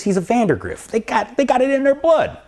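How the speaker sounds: high-pitched, impassioned pep talk; worked-up retold exclamation; Italian-style fervor. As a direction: pitch soaring as you insist on it. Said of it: "That's the Italian in me coming out".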